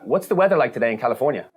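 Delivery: loud, clear command to the assistant; talking to voice assistant. Shown as a demonstration. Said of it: "We could say".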